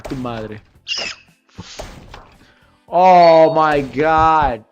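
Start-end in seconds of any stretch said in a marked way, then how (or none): none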